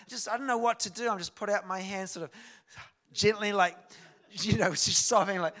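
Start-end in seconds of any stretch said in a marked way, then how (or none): none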